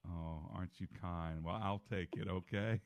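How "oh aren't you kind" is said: ordinary talk, nothing marked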